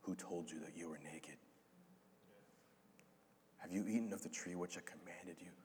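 a father's voice breaking with sorrow; heartbroken. Retold or imitated.

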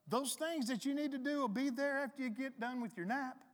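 high-pitched imitation of a reassuring woman; Trina. Imitating her telling him to rest, high and coaxing. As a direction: lift the voice high to coax. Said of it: "she'll tell me stuff like".

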